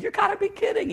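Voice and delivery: it bursts out of him; a father refusing to believe the news; stunned disbelief. Retold or imitated. Told his son will die.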